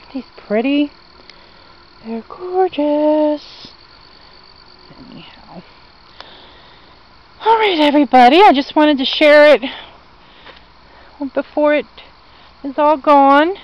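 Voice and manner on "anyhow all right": normal talking voice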